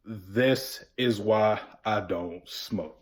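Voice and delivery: deadpan, slow and low; straight face. Retold or imitated. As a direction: say it dead serious, dragging it out. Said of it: "I say with a straight face".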